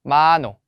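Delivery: 마노 with a loud, stressed 마; penultimate stress. Shown as a demonstration. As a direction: hit the first syllable of 마노, drop the second. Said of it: "여기에 강세를 줘야". (마노의 '마'를 크게 강조)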